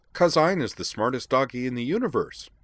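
high, loud, excited praise; gushing girlish voice. A narrator's reading. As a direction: enthuse over the doggy. Azalin gushes over the corgi.

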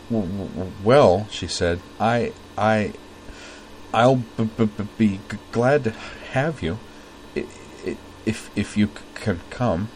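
halting stutter, soft and unsure; stammering shyly. Acted. Caitlin shyly welcoming Jury along, stammering. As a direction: trip over every start, nervous.

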